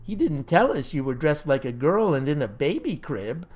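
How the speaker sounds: ordinary delivery, nothing marked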